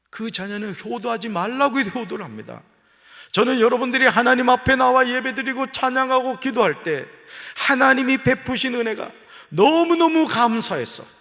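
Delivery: loud, high pulpit preaching; fervent preaching. Real, not acted. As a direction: drive it home to the congregation. Preaching at full force.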